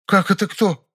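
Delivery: high-pitched, incredulous woman; astonished. A narrator's reading. Nyura thinks everyone knows her mistress, astonished. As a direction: ask as if it were obvious. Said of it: "Изумлённо посмотрел на меня Нюра".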